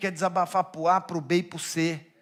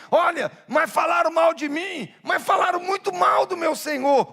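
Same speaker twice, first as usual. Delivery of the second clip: high, raised-voice lament; acted loud complaint; 9 semitones higher, 4 dB louder than usual. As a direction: cry out the grievance in a high voice.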